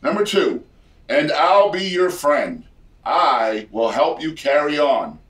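mock game show announcer. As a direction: croon the lines like a showy emcee. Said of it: "a set of lyrics in a game show voice".

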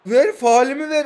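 yelling at the referee; angry demand. Real, not acted. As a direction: insist on the foul call.

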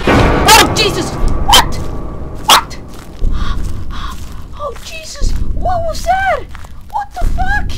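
terrified shrieks. Real, not acted. Player ambushed by the monster, high terrified screaming. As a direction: shriek in real terror.

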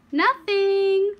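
put-on high, innocent little-boy "Nothing"; innocent child. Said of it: "Little Snow said".

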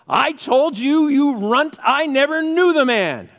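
high, loud, drawn-out rebuke; angry shouted denial. Acted. Peter rebuking the slave girl, angry yelling. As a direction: yell it down at a pestering child.

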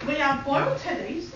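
ordinary talk, nothing marked

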